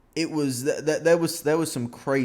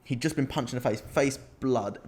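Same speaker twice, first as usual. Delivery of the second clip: normal talking voice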